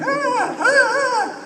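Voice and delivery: very high squealing mock scream; hammed-up high-pitched fan scream; imitated screaming. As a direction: squeal like excited fans. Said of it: "a group of ladies who are just screaming".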